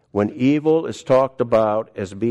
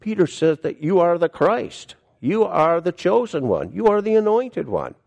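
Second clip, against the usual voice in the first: much higher, ringing proclamation; lifting each title higher and higher; fervent preaching; 8 semitones higher than usual. Acted. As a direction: proclaim it, climbing with each line. Preacher building to a climax.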